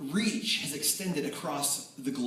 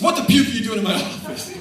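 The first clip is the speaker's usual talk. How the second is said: high, loud joking coach bark through a laugh; mock coach outburst; 10 semitones higher, 12 dB louder, faster than usual. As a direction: ham up the gruff coach. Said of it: "He says".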